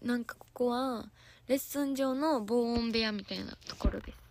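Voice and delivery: usual speaking voice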